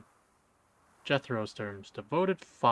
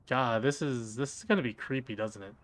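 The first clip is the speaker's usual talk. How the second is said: normal talking voice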